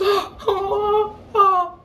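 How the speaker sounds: high-pitched mock sobbing gasps; squeaky gasps, imitating an overwhelmed little girl; excited child gasps. Imitates delighted seven-year-old.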